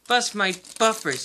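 surprised cry. A narrator's reading. Thomas spots the engines parading past, crying out. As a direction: cry out with a touch of amazement. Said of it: "he cried".

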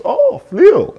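a big high jump of surprise on "fleal"; coach, suddenly impressed, yelps the word; surprised coach. Retold or imitated. Coach surprised by his answer.

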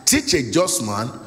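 rising preacher's cadence over music; preaching. Acted. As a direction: proclaim it to the congregation. Preacher quoting scripture with music.

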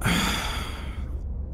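long grudging exhale; reluctant sigh. Acted. Gatekeeper about to accept the bribe, reluctant sigh. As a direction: sigh before giving in.

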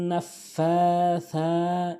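নাফাসা slow, both long vowels held; elongation. Shown as a demonstration. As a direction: stretch the "faa" and "saa" of নাফাসা. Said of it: "এক আলিফ করে টেনে পড়তে হবে". (নাফাসা টেনে টেনে)

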